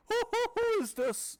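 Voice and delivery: very high, slow stammering king; hammed-up high king voice; demanding king. A narrator's reading. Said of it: "the king demands".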